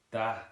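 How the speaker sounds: "Da" for that, D for TH; clipped-end "Da", no final consonant; Southern Irish pronunciation. Shown as a demonstration. Teacher demonstrating Southern Irish, "Da" with D for TH. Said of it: "Dropping the T H and instead having a da".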